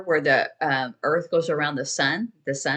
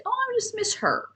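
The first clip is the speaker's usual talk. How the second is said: much higher, sighing with longing; wistful longing. Shown as a demonstration. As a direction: let the voice carry the missing, pitched high. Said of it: "'Cause your voice will say".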